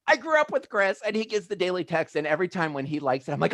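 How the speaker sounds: high-pitched excited schoolkid squeal; giddy seventh grader. Acted. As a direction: gush like a starstruck kid. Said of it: "I'm in seventh grade".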